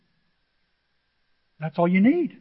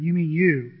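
usual speaking voice

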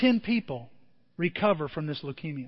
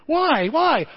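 higher, louder mock cry of protest; hammed-up higher, louder why; mock wail; 8 semitones higher, 5 dB louder than usual. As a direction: wail it like a dismayed patient. Patient protesting the visit.

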